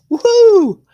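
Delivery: very high, loud whoop; cheering. Real, not acted. Host celebrating a new notification live, loud whoop. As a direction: let out a full-throated cheer.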